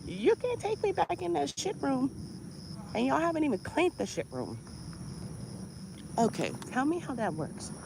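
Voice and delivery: high, soft-voiced objection; hushed protest. Patient objecting to nurse about the room, hushed protest. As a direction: keep it small and high, pleading your case.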